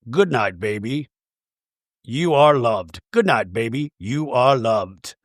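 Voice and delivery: low recorded-toy man's voice; Jared. Retold or imitated. Pressed toy unicorn saying good night, low and soothing. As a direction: imitate a stuffed toy's low, tender message. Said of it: "Is that Jared's voice?".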